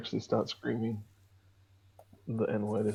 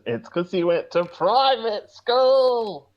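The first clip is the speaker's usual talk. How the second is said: much higher, slow and drawn out; reading a comment in a mock voice; 9 semitones higher, 4 dB louder, much slower than usual. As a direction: read it out as a hammed-up taunt. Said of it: "Risey Lee says".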